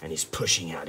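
ordinary delivery

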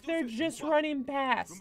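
high-pitched, drawn-out cry; alarmed call. Real, not acted. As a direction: call it out high, stretching the words. Alarmed reaction to game action.

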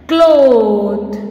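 "Cloth" drawn out on one long vowel; pronunciation demo. Shown as a demonstration. Teacher showing students how to pronounce it, drawn-out demo. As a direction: say "Cloth" slowly, stretching the o. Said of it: "how we will pronounce it".

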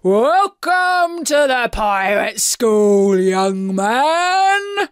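slow, loud pirate captain's welcome; booming. A narrator's reading. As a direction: boom it out grandly, stretching the words. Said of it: "he boomed".

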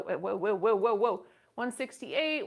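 usual speaking voice

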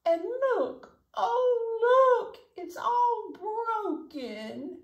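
high cub voice breaking into a cry, slow at the end; a little bear wailing over his broken chair; Baby Bear crying. Acted. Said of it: "Baby Bear started to cry".

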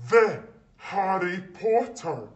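awed exclamation. Acted. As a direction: marvel at the famous name. Hall whispering in awe.

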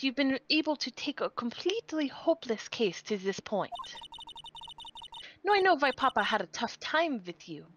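put-on haughty German-accented prosecutor; light stagey German accent. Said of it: "Flip-flopping between a Southern and a German accent".

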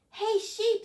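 high, loud hail to a friend; calling out. Acted. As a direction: get a friend's attention loudly. Bird calls out to Sheep.

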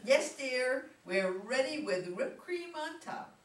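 high, loud indulgent reply; calling back. Acted. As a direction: humour the child, calling upstairs. Mother calls back upstairs.

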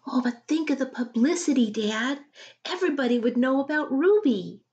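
a hint of a keen daughter planning; eager girl. Julia persuading her dad.

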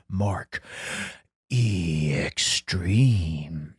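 low, very slow and soft title drop; dramatic name drop. Acted. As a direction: savour your own name like a movie trailer. Host announces his own name.

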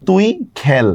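খেল said 'khael', with a wide ae; open ae demo. Shown as a demonstration. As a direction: open the vowel of খেল as in 'cat'. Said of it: "যেমন আমি বললাম দেখেন".

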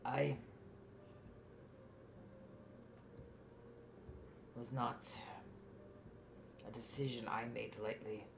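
halting confession with long pauses; remorseful father. Acted. Game master voices remorseful father explaining.

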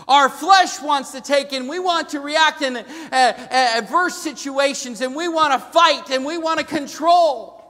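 high, loud preaching drive; preaching at full force. Acted. Pastor drives his point home to the congregation, loud and high. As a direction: preach it out to the pews.

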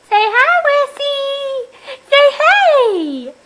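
put-on high squeaky baby voice. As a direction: speak for the baby, high and cute. Mom voicing baby, high pitched.

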